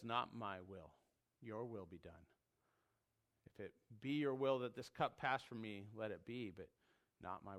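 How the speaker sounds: "Not my will" soft and low, yielding; quiet submission. Retold or imitated. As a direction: surrender it in a hush. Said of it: "It's a submission".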